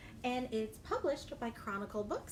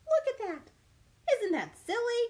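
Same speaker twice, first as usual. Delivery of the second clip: amused delight; 10 semitones higher, 6 dB louder than usual. Acted. Laughing at flung pea.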